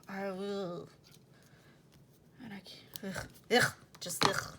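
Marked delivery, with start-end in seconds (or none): 3.2-4.5 loud exasperated "ugh"; loud groan. Real, not acted. Venting about a day she has not been happy, loud exasperated groan. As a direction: groan it out louder than your talk. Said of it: "I know that was loud".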